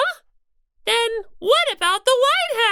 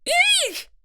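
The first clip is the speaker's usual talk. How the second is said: high startled eek; startled squeak; 7 semitones higher than usual. Acted. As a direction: squeak in alarm.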